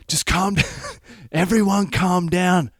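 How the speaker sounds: high-pitched urgent plea; panicked calming. Retold or imitated. Friend intervening as a gun is pointed, panicked.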